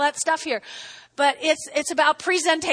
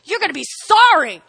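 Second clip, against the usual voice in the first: loud, higher mock threat; threatening coworker; 8 semitones higher, 8 dB louder than usual. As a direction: snap at the coworker like you mean it. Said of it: "You go".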